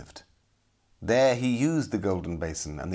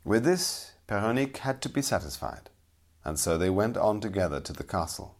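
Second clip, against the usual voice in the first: plain reading voice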